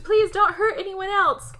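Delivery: high imitated plea; hammed-up begging, pitched well up; mock pleading. Daughter to her killer father, high mock pleading.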